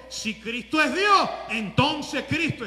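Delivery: loud, slow shout on Cristo es Dios, then easing; rising preacher's cry; preaching shout. Real, not acted. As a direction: hammer the point home to the congregation. Preacher builds to his point.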